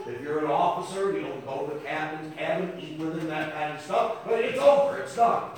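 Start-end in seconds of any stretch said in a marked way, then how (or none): 4.2-5.5 loud, higher, driving sermon cry; a full-throated preacher's build; preaching at full force. Acted. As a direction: thunder it out from the pulpit. Preaching peak.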